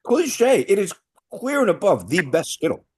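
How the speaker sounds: high, loud indignant mockery; mocking indignation. Real, not acted. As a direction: throw the word back, outraged. Mocking partner's word, defending choice.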